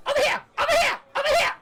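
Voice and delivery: very high, loud gnome voice; shrill little gnome, eager to please; tiny gnome shouting orders, high and loud; squeaky gnome. Acted. Gnome calling out.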